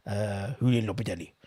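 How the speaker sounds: retry the name and slide into a Russian accent. Shown as a demonstration. Streamer corrects the manager's name but sounds mock Russian. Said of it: "that sounds like a Russian accent".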